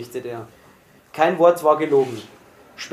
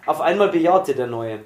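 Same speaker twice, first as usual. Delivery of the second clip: usual speaking voice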